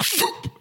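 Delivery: short puff of air, "phoop"; imitated spear gun hit. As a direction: mimic the whoosh of a shot. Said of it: "I was just like".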